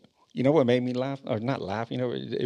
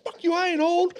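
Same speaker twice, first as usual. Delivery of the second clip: high, loud indignant yell; mock angry shout; 3 dB louder than usual. Dad yelling back at teens who called him old, mock angry shout. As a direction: fire back, wounded pride. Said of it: "and I'm like".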